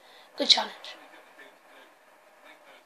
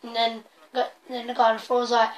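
ordinary delivery

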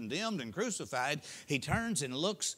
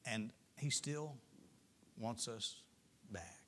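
slower, softer down to a whisper on "back"; tender fading; 3 semitones lower, 9 dB quieter, slower than usual. Real, not acted. Preacher assuring sinners, fading to a whisper. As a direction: let it fade into a whisper.

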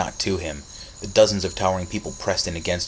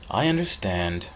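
ordinary delivery, nothing marked

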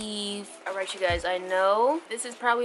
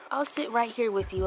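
ordinary delivery

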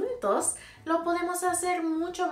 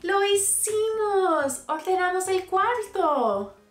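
high, loud proud cheer; excited cheering; 9 semitones higher, 5 dB louder than usual. Acted. Teacher shows the kids the clean room, excited. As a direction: celebrate loudly for the kids.

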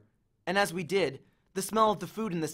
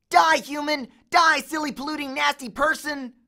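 much higher, louder frantic yell; gaming frenzy; 10 semitones higher, 7 dB louder than usual. A narrator's reading. Grover yelling at the game targets. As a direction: rant at the screen.

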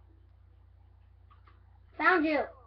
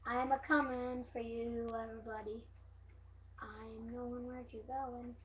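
creepy seeker voice; 4 semitones lower, 14 dB quieter than usual. Acted. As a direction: creep up, menacing whisper. Seeker creeping, spooky tease.

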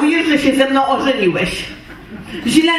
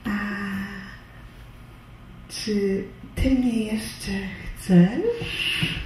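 much softer, slow and coy; tender quiet ask; 5 semitones lower, 9 dB quieter, slower than usual. Acted. Wife softly asks husband if he still wants her, tender and quiet. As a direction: coax him gently, almost whispering.

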